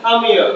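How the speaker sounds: "Come here" as one word, kamia; native linking. Shown as a demonstration. Teacher answers students, fused "Come here". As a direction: drop the h and blend "Come here". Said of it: "এরকম".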